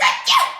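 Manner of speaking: a very loud, high, explosive achoo; huge sneeze. Acted. Wolf sneezes the stick house down with a huge achoo. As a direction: let a giant sneeze rip. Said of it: "a great sneeze".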